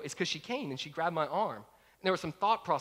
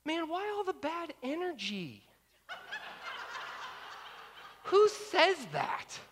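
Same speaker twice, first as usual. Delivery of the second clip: mock sass; 13 semitones higher, slower than usual. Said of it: "here was my answer to him".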